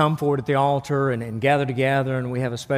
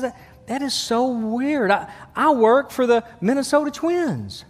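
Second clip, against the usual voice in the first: amazed new father; 7 semitones higher than usual. Acted. Father delivers punchline.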